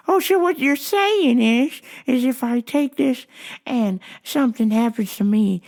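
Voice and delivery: lightly voiced old man, starting high; questioning old customer. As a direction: check back as a puzzled customer.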